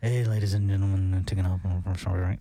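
very low, laid-back stoner drone; chill stoner pilot. Acted. Host mocking too-relaxed pilots, in a chill stoner voice. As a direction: make the captain deep and spaced-out. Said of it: "chill, like, Cheech and Chong".

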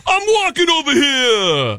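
high, angry mock New Yorker; New York accent. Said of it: "is New York".

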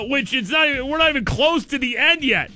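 ordinary delivery

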